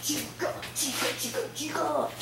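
soft breathy whispered Chica call. Pretend. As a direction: whisper the name like a spooky animatronic. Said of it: "That's not what he sounds like".

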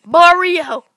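loud high pleading shouts; frantic shouting; desperate yell. Real, not acted. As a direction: call out in panic. Desperate calling out.

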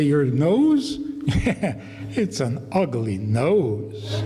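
chuckling, slow "it's an ugly nose"; laughing agreement. Pretend. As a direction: laugh along with the kid. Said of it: "You say".

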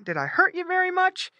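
dog's high-rising question; concerned dog. Acted. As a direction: check kindly on the piglet. Dog checks on piglet.

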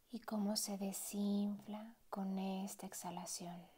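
unhurried meditation voice with long pauses; guided meditation. Acted. Meditation guide easing a listener into relaxed breathing, guided meditation voice. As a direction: guide the listener gently, letting each phrase settle.

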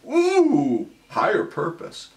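high squealed ooh; a hammed-up swoon of delight; mock thrill. Dad imagining his noble watch duty, mock squeal.

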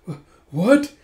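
high stammered acted shock; startled. Girl as drunks claw at her rescuer, startled. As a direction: stammer as if caught off guard.

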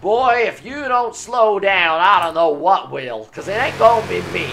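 slow, drawn-out attempt at American; badly faked American accent, stretching the words. Gamer taunting in an atrocious fake American accent. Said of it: "What the hell was that accent I attempted".

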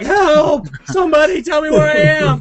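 high, loud mock cry for help; hammed-up shout for help, voice jumping up; mock yell for help. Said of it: "I'm like".

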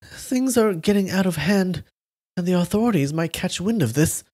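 high, shaky and nervous. Acted. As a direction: tremble with worry. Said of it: "said in a shaky voice".

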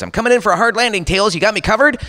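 high, fast cartoon hero; Sonic. Falling hero calls to sidekick, high urgent cartoon voice. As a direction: call it out quick and high mid-fall. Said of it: "Sonic's falling through the sky".

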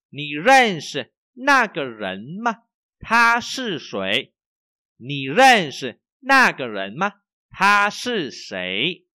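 slow, loud, clear Chinese to repeat after; slow model reading. Shown as a demonstration. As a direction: read it out slowly for students to echo. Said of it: "Đọc lại nhá".

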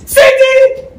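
calling out. Acted. Calling the worker from afar.

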